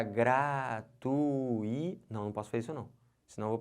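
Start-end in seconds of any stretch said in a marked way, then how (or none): 0.0-2.0 "gratui-" dragged out as gra... tu... í; syllables pulled apart. Shown as a demonstration. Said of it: "Eu não posso separar". ("gratui-" separado, gra-tu-í, bem arrastado)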